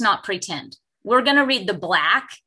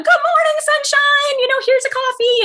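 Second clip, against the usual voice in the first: much higher and louder, sing-song morning cheer; chipper morning greeting; 5 dB louder than usual. Retold or imitated. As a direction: beam it out like the office's bright morning person. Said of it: "and I'm".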